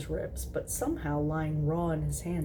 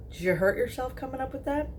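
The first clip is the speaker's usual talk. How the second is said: teasing; 8 semitones higher, faster than usual. Acted.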